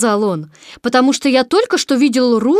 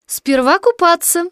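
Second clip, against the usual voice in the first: slow, higher, lightly cheerful mother; 7 semitones higher, 3 dB louder, slower than usual. A narrator's reading. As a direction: announce bath time brightly and unhurriedly. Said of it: "весело сказала Кенга". (весело, нараспев)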